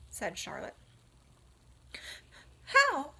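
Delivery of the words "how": much higher, much louder; astonished. Acted. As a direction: blurt it out. Wilbur astonished.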